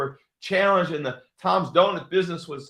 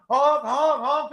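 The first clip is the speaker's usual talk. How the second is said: mock honk; 9 semitones higher, much slower than usual. Driving the red truck through town, mock honking.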